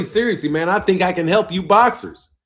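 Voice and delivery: ordinary delivery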